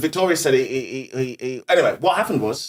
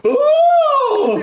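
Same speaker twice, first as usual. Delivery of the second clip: high loud mock crowd gasp; comic mimicking the shocked crowd; crowd ooh; 5 dB louder than usual. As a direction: play the shocked audience going ooh. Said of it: "The crowd".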